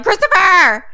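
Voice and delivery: high, loud scolding cry; alarmed shout. Acted. Everyone yelling at boy with gun, alarmed shout. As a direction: shout the child's name in alarm. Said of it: "they're all like".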